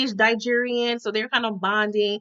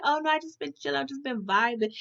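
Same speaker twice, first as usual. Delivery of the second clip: mock chill brush-off; 3 semitones higher, 8 dB quieter than usual. [name] brushing him off.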